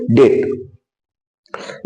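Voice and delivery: डेट whispered, slow and drawn out; soft repeat. Shown as a demonstration. Teacher softly repeating डेट for pupils. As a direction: breathe डेट softly for the class. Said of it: "क्या पढ़ेंगे?".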